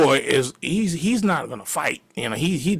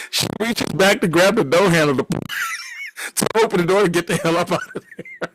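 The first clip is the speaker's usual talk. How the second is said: usual speaking voice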